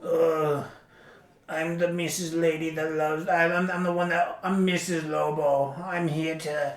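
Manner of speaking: a hint of a grand crime boss lady; impersonating Missus Lobo. Mocking the villain mom while reviewing the movie, lightly voiced. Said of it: "this is my impersonation of her".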